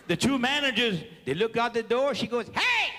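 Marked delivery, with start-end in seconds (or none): 2.5-2.9 a high, sudden excited yelp; excited Cajun hey. Retold or imitated. A Cajun woman at the casino door calling out, high and excited. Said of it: "when a Cajun gets excited, his voice changes".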